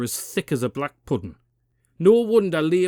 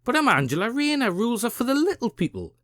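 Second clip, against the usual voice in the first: fast, higher, mock entitled Rayner voice; 8 semitones higher, faster than usual. Commentator voices the politician at the tax office, mock entitled. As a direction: rattle it off like a smug politician. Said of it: "picture her at the tax office".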